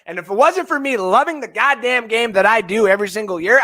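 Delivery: ordinary talk, nothing marked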